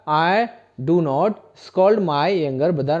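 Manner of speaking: very slow, word by word; teacher models the answer very slowly. Shown as a demonstration. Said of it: "क्या बोलेंगे?".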